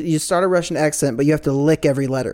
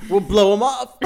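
laughing; 7 semitones higher than usual. Real, not acted. Laughing through the line.

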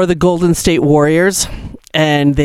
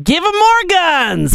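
high mock voice of a gun-rights politician; mocking Republicans. Cohost mocks Republican answer to shootings, high mock voice. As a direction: squeak it out like a cartoon politician. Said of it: "They're like".

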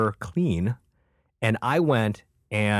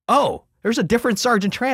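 much higher and louder; mock discovery; 6 dB louder than usual. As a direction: announce it wide-eyed. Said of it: "and he goes".

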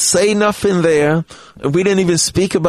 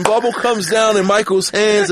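usual speaking voice

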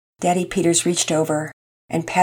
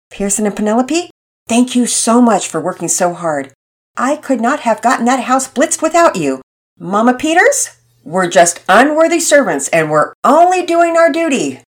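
cheerful mother; 6 semitones higher, 5 dB louder than usual. Acted. Mother thanks children, joking.

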